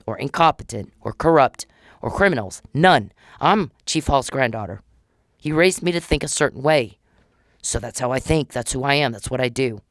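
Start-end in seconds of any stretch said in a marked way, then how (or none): none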